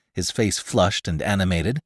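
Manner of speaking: ordinary delivery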